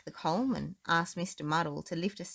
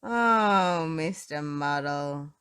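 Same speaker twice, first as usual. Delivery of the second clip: slow, louder despairing fondness; exasperated George; 6 dB louder, much slower than usual. A narrator's reading. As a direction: drag the name out. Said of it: "George was just about to say".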